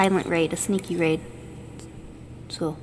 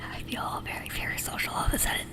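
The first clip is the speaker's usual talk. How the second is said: whispered ASMR with mouth sounds; soft close whisper, mouth sounds; tingly asmr mouth sounds; 12 dB quieter than usual. Real, not acted. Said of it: "I'm gonna do some mouth sounds".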